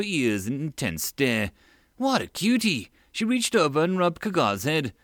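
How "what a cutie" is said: gushing. Acted. Megan coos at Kagar.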